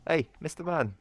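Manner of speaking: high sing-song hello to a pet; greeting the cat. Real, not acted. Streamer greeting his cat in a high sing-song voice. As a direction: chirp a high hello to him.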